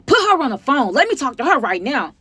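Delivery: loud, high, bossy demand; imitated yelling mom. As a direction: mimic an angry mother giving orders. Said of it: "yell at me like I'm one of yours".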